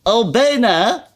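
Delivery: high, slow disbelieving echo; queen scoffing at Alice's question; incredulous. Acted. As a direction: throw the words back in disbelief. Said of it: "herhaalde de koningin".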